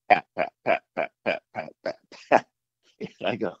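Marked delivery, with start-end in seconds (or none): 0.1-2.5 quick, even pats mimicking running feet; imitated running footsteps. Hunter imitating feet running down the road, mimicked footfalls. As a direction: sound out approaching sneaker slaps. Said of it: "it's going like".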